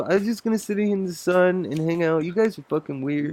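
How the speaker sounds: dog voice. Pretend. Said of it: "do your version of the dog voice".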